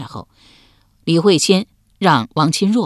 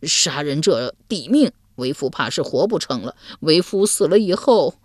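usual speaking voice

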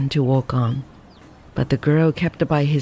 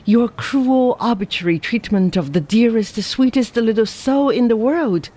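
put-on higher, indignant young woman; 6 semitones higher than usual. A narrator's reading. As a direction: scold him with a touch of outrage. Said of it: "with indignant eyes".

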